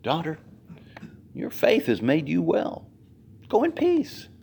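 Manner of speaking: Jesus gently blessing the healed woman; tender. Shown as a demonstration. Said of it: "Have you ever considered how Jesus said that".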